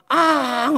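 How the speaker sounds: sudden high mock wail; imitated crying wail. Pastor imitating a student bursting into tears beside him, mock wail. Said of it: "하고 울어요".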